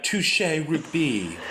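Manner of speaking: "Touche rugby" slow, fancy French "touché"; teasing her posh "Touche rugby"; posh Frenchified. Retold or imitated. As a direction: stretch "Touche rugby" like a toff. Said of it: "You said it more like".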